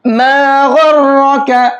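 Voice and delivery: slow chant of मा गुर्रका with thick, heavy ghain and ra. Shown as a demonstration. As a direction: Quranic recitation, heavy letters. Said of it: "गईन और रा दोनों को मोटा करके पढ़ेंगे".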